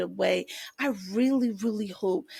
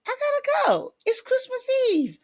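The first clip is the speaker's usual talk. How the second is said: much higher, playful squeaky voice; silly high voice. Pretend. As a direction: pop up into a goofy high register to bow out.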